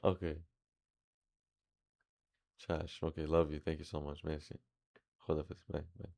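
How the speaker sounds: soft, low off-hand murmur; real hushed phone chat, pitched well down; private phone aside. Real, not acted. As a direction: keep the call down during class. Private phone call during live class.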